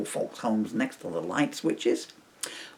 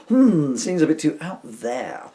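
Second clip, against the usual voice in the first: slower, louder boss retake with a hum; 5 semitones higher, 8 dB louder, slower than usual. Shown as a demonstration. Reader retaking the boss's doubtful reply, slower. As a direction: ponder it slowly as the boss. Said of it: "focus, boy, focus".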